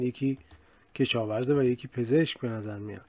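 usual speaking voice

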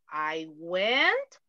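words drawn out one by one for students; slow model sentence. Shown as a demonstration. Teacher repeating the example for students, slowly. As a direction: pace it slowly like dictation. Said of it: "Todo en pasado".

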